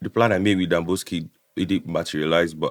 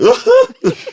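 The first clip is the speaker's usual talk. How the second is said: very high squeal of laughter; 9 dB louder than usual. Real, not acted. Laughing about Mensah crying for his mother. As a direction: shriek of a laugh, high and loud.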